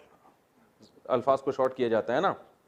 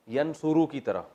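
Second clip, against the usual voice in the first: ordinary talk, nothing marked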